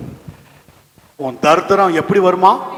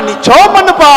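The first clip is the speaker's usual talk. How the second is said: high, loud command; shouted urging; 10 semitones higher, 8 dB louder than usual. Acted. Sailor telling the prophet to pray, shouted urging. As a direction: urge him forcefully. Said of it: "அவன் சொல்றான்".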